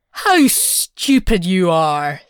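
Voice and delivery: exasperated cry. A narrator's reading. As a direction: scold her fondly with a laugh in it. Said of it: "her husband cried".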